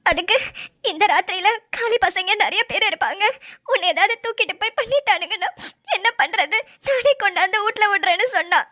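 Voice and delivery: tearful, distressed retelling; weepy account broken by sobs; sobbing. Acted. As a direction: quote the harasser through tears. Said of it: "தேம்பி தேம்பி அழுதுனா".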